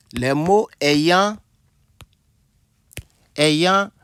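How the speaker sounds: slow, piece by piece, each ending on a rise; slow dictation. Real, not acted. Teacher dictates the definition to students, slow dictation. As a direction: dictate it in chunks with long pauses.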